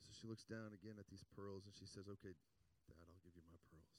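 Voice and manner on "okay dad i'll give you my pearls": soft, lowered, giving-in voice; quiet surrender. Retold or imitated. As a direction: give in quietly as the retold daughter. Girl surrenders her treasured necklace.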